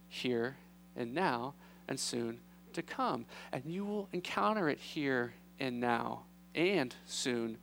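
low, soft and slow, with long pauses; calm them slowly and quietly; gentle reassurance. Acted. Jesus comforting his disciples before leaving, gentle reassurance.

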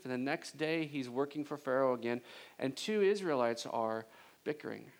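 ordinary talk, nothing marked

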